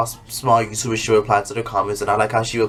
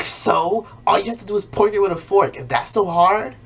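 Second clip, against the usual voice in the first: higher, dismissive sass; sassy retort; 7 semitones higher than usual. Retold or imitated. Miranda sassing her mom, higher and dismissive. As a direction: brush off the broken arm. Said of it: "And she's like".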